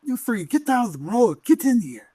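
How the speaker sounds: high-pitched, urgent French character; skinny French guy. Acted. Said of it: "he's like".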